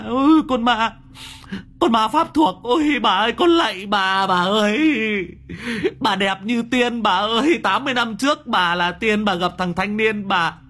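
high, loud panicked begging; terrified shrieking. Acted. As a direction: shriek for mercy at the ghost. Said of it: "nó rú lên".

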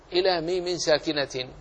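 normal talking voice

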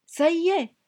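put-on high, loud triumphant child's cry; cried out. A narrator's reading. A girl running to her mother's skirts, crying out high. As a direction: burst out with the news, high and loud. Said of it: "avait-elle crié".

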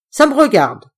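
loud, gruff snap; a gruff uncle cutting her off; gruff growl. Acted. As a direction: growl it, brusque. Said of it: "gronda Coriolis d'une grosse voix bourrue".

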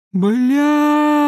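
very high, loud yell; battle cry. Acted. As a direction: bellow it as you're hit. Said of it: "выдал Артур боевой клич".

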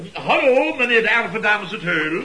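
loud eager salesman phone greeting; showy phone greeting. Shown as a demonstration. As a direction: put on a bright salesman voice for the phone. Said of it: "Let op".

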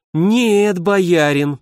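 slowly drawn-out "Нет, боярин". A narrator's reading. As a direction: stretch the words out, cool and firm. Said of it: "протянула Соблеслава". (протяжно, холодно)

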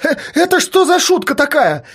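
high-pitched indignant cry; startled, high yell; shouting in alarm. Acted. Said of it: "закричал".

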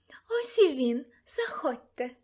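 madam's singsong invitation. A narrator's reading. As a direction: sing it out as a welcome. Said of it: "проспівала мадам Россіні".

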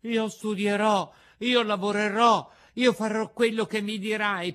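high, loud, lively vow; eager vow. A narrator's reading. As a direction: throw yourself into the promise. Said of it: "rialzando vivacemente la testa".

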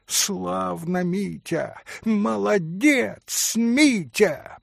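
high cheer with a malicious edge; spiteful exclamation. A narrator's reading. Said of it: "страшно злобная нотка прозвенела". (злорадный звонкий возглас)

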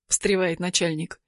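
ordinary delivery, nothing marked

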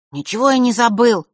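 loud, high denial; angry retort. A narrator's reading. Брат в ярости отвечает брату. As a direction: fling it back at him. Erik shouting back.

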